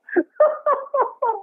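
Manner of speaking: high squealing laugh that cracks. Real, not acted. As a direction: crack up high over the prank. Laughs at hot sauce prank.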